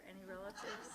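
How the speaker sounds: a teasing question through a laugh; host teasing the guest about his father, laughing; laughing tease. Real, not acted. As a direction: tease him while laughing.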